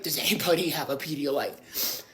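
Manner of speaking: low, soft, slow hungover croak; low slow hungover plea to the girls. Retold or imitated. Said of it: "I'm like".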